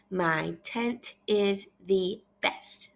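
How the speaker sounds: half-speed, every word separate; measured teacher reading; slow read-aloud. Shown as a demonstration. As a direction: model it slowly for repeating. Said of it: "I want you to repeat after me".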